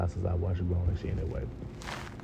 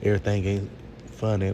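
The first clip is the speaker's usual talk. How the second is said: ordinary talk, nothing marked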